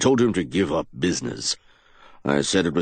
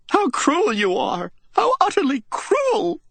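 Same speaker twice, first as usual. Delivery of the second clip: tearful outburst. A narrator's reading. Widow bursting into tears at the captain, crying out. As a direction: wail it through tears. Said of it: "she burst into tears".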